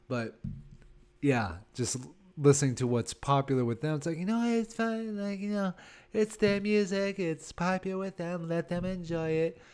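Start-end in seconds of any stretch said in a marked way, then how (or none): 4.2-9.6 mock tolerance. Older comic excusing young people's music, mock tolerance. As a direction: feign easygoing acceptance in a higher voice.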